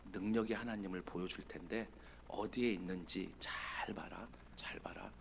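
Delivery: hushed, soft calling; God's whisper. Acted. God gently calling Elijah out of the cave, whispered. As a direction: coax him out in a whisper.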